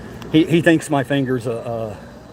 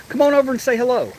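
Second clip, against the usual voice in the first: high, fast coaxing call; 9 semitones higher, faster than usual. Real, not acted. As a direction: sweet-talk the animals over. Coaxing goats.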